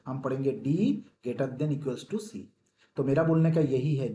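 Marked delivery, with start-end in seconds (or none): 0.7-2.4 slow, deliberate reading. Shown as a demonstration. Teacher showing students the correct reading, very slow. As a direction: draw out each word for the class. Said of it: "हम पढ़ेंगे कैसे".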